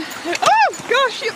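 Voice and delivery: high startled squeal. Real, not acted. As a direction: yelp as water splashes. Splashed by dog.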